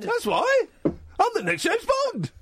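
very high, drawn-out caricature; slow squeaky boast; Paul Innocent. Acted. Host boasting as the caller, very high mock voice. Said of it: "I can do an impression of you".

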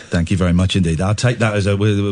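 ordinary delivery

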